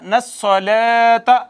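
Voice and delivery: slow, drawn-out chanted recitation; Quran recitation. Shown as a demonstration. Teacher reciting the verse for students, slow chanted recitation. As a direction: recite each word slowly, stretching the vowels. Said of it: "recites part by part".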